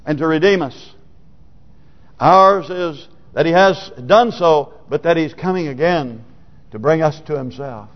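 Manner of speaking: ordinary talk, nothing marked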